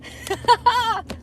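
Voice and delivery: high laugh. Real, not acted. Laughing at going home hungry.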